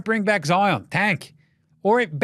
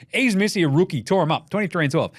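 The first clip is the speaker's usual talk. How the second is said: ordinary talk, nothing marked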